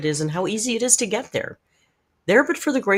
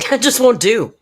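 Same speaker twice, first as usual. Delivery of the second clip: a laughing, higher and louder mock-haughty That just won't do; mock indignant; 7 semitones higher, 9 dB louder than usual. Streamer hamming up a mock complaint to chat, haughty and indignant. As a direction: huff it out like an offended aristocrat.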